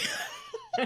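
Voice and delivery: squealing little laugh; high-pitched giggle. Real, not acted. Laughs at her own slip.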